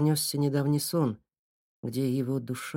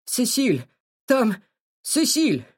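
panicked cry; 10 semitones higher, 6 dB louder, much slower than usual. Acted. Panicked boy crying name.

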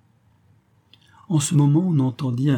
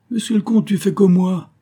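high, loud retort; shouting back; 10 semitones higher, 6 dB louder than usual. A narrator's reading. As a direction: call back forcefully over the water. Raoul shouts back while swimming.

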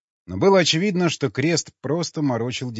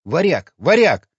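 loud, high calls, second stronger; urgent calling; 6 semitones higher, 9 dB louder than usual. A narrator's reading. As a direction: call him insistently, rising in force. (настойчивый громкий оклик)

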